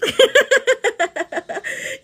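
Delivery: high, loud giggling fit; long run of high giggles; laughing. Real, not acted. Laughing at viewer comments.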